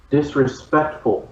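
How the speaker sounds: usual speaking voice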